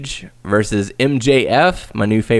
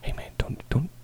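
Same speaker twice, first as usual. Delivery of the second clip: a hushed, menacing joke threat, barely voiced; mock whispered threat; 12 dB quieter than usual. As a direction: whisper the threat like a movie tough guy.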